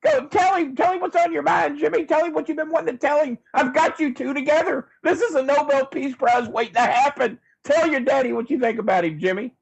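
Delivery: host whipping up a fake father-son reunion, gleefully excited; hyped reunion host. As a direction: sell the reunion like a carnival barker. Host hypes the staged reunion.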